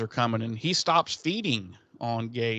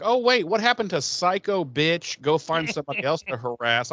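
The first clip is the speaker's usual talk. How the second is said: sarcastic; 5 semitones higher, 3 dB louder than usual. Retold or imitated. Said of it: "And I'm like".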